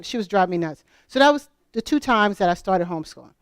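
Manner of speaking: usual speaking voice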